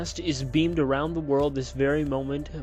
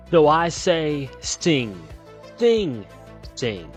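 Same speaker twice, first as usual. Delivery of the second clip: louder, rousing call to sing; rousing preacher's call; 3 semitones higher, 6 dB louder, much slower than usual. Acted. Reverend urges his flock to sing, booming call. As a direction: fire up the congregation.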